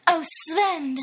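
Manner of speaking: breathy and high; breathless. Acted. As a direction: sigh it out breathless. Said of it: "said Brenda breathlessly".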